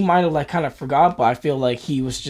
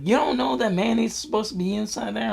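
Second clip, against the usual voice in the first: higher, put-on older woman's voice; high mock-mom voice, a bit incredulous; imitating ex's mother; 9 semitones higher, 3 dB quieter, faster than usual. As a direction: tease him like a knowing mother. Ex's mother teasing retold quote.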